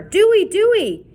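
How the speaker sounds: high, loud panicked scream; boy screaming for his drowned friend; screaming name. A narrator's reading. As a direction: scream for a friend who vanished. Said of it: "Chet ran screaming".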